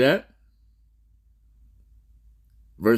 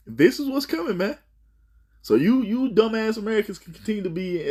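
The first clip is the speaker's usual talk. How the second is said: much higher, heated scorn; preacher jumps up in pitch to scold; rising fervor; 11 semitones higher than usual. Real, not acted. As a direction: let it fly up at the doubters. Preacher flares up at listeners.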